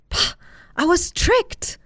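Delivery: slow, high, disgusted outburst; disgusted farmer. A narrator's reading. Farmer realising the trick, slow disgusted outburst. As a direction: spit it out in disgust. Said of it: "Jack muttered in disgust".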